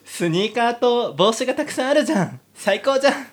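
giddy heroine. Acted. Heroine swooning over the soldier, giddy high voice. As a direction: play the lovestruck girl.

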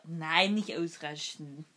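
ordinary delivery

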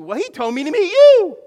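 high, very loud acted amazement; shouted excited discovery. Friend answers he was told to meet you, shouting excitedly. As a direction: top the first voice with a big excited yell.

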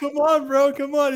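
high coaxing come-on; luring friend. Retold or imitated. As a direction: beckon sweetly, high. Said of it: "He's like".

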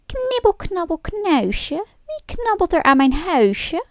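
high, dainty little voice calling out; a sweet, tiny voice from inside the house; small high voice calling from within; fine little voice. A narrator's reading. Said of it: "riep een fijn stemmetje".

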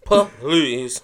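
drawn-out, scoffing "puh-lease". Real, not acted. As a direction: stretch it into two mocking syllables. Mocking repeat, drawn-out syllables.

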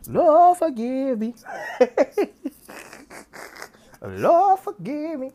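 high, loud cry to the Lord, breaking into laughter; laughing mock prayer. Admitting he'll sin again, laughing plea to the Lord. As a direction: plead to heaven while cracking up.

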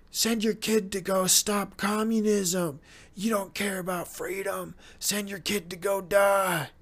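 mock recruiter. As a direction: sneer out the call to send kids off to die, building to a shout. Mocking war recruiters' demands.

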